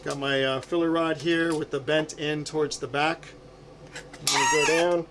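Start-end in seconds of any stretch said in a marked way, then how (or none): 4.0-5.1 muffled. Real, not acted. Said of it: "I apologize if the sound is a little muffled".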